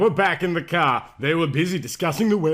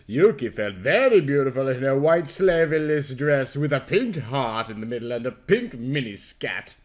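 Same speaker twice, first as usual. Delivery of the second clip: ordinary delivery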